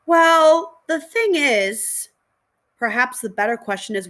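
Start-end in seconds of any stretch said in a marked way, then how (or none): 0.1-2.1 hesitant stall. Acted. Caught holding dog, stalling.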